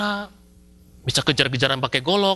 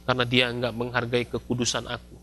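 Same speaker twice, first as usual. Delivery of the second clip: lower, softer solemn reply; voice of God; 6 semitones lower, 6 dB quieter than usual. Retold or imitated. God answers Moses, low and solemn. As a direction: answer quietly with grave authority. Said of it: "Tuhan bilang".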